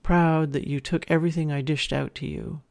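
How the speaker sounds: usual speaking voice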